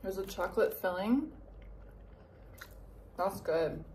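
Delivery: speaking between bites of cookie; slightly full mouth; talking while chewing. Real, not acted. Seen 2: She holds a bitten cookie, lips pursed as if chewing between lines.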